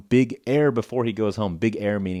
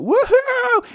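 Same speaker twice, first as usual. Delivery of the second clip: woohoo; 8 dB louder than usual. Retold or imitated. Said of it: "He went".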